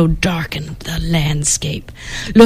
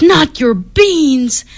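slow, higher, outraged protest; 7 semitones higher, much slower than usual. Acted. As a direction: stretch it out in outrage. Skipito outraged about beans.

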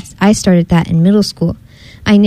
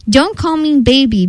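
higher, mildly indignant cub; indignant exclamation; 6 semitones higher than usual. A narrator's reading. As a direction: protest lightly, in storybook fashion. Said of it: "Bee Bear exclaimed".

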